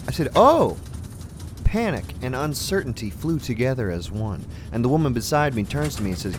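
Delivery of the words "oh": high, loud panicked cry. Retold or imitated. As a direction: yelp in sudden terror. Plane shaking, panic.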